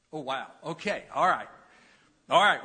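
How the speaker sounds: normal talking voice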